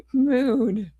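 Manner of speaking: slow, drawn-out grumble breaking into a laugh; pouty half-laughing complaint; mock sulk. Real, not acted. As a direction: whine it slowly, then laugh. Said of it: "I'm in a foul mood".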